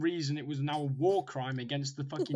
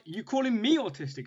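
offended challenge; 6 semitones higher, 4 dB louder than usual. Retold or imitated. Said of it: "took that very personally".

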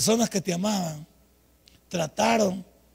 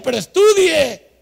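slow, loud, higher plea to study; mother insisting; 7 semitones higher, 10 dB louder, slower than usual. Retold or imitated. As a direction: press it on the child like a mother. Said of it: "Ella lo decía". (madre insistiendo, lenta y fuerte)

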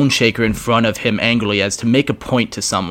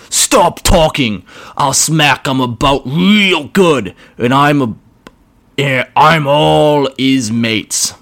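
higher, slow growling orc boss; a brutish orc taking his time; 5 semitones higher, 4 dB louder, slower than usual. Acted. Orc arguing with himself.

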